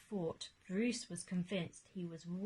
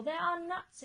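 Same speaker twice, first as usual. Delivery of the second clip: high, louder protest; exclaiming; 8 semitones higher, 4 dB louder than usual. Acted. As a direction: exclaim it after the bird. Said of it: "exclaimed Bruce".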